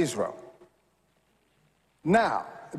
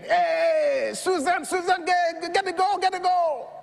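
sideline cheering; 9 semitones higher than usual. Retold or imitated. Parent yelling to daughter Susan at her soccer game, high cheering. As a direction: cheer your child on from the sideline.

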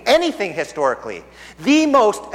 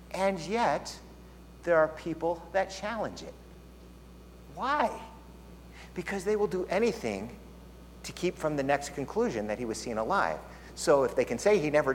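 a pastor confiding to the congregation, softly; hushed intensity; 11 dB quieter than usual. Real, not acted. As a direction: lean in and say it softly. Drops to intense low voice.

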